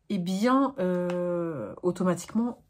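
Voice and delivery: ordinary delivery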